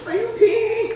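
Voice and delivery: high, loud, laughing question to the dog. Real, not acted. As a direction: laugh it at the puppy. Dog pees in excitement.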